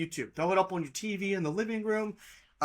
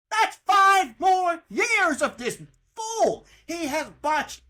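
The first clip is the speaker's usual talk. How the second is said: slow, very high, loud disgust; outraged shout; 9 semitones higher, 5 dB louder, slower than usual. Real, not acted. Fan ranting about the athletic director, slow outraged shout. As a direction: drag each word out in fury.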